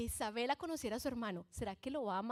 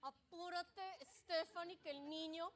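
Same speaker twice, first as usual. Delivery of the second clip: much softer, hurried urging; husband quietly urging his wife to hurry; husband's hurried mutter; 4 semitones higher, 12 dB quieter than usual. Retold or imitated. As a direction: rush her along quietly. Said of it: "esa es la cara de Alfredo".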